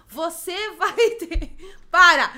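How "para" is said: sharp, loud "stop". Real, not acted. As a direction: cut them off loudly.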